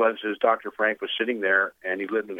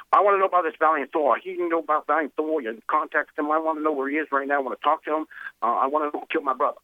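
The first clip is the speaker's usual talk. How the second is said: raised, pushy voice, fast in places; insistent demand; 7 semitones higher, faster than usual. Retold or imitated. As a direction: demand it like a man used to getting his way. Bobby Kennedy demanding answers.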